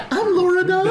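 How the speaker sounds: high squeaky mocking echo of I'm Laura Dove; teasing falsetto repeat; mock high imitation. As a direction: parrot her name back in a squeaky voice.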